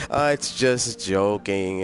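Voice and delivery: normal talking voice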